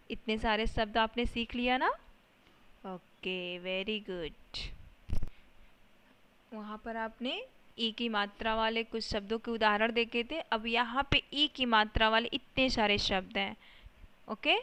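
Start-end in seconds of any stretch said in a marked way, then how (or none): none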